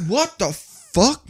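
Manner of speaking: high, slow drawn-out shock; shocked disbelief. Retold or imitated. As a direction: stretch it out in comic shock. Acted reaction to friend's panic.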